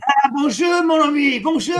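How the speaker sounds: high, hammed-up French greeting; mock French accent. Host greets French viewer in a hammed-up French accent. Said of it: "You got a decent accent".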